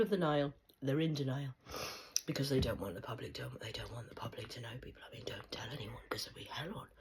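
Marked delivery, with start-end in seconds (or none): 3.6-5.0 drops to a fast, much softer and lower whisper. Real, not acted. Repeating the secret to viewers, now whispered. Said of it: "whispered repeat". 5.1-6.9 much softer and lower secret whisper. Real, not acted. Begging viewers not to tell, whispering.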